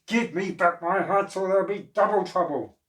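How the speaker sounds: loud furious threat. Acted. Furious hat seller stamping at the monkeys, loud shout. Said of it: "The man was furious".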